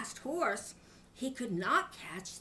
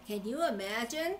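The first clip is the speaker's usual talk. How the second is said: plain reading voice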